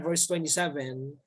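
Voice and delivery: normal talking voice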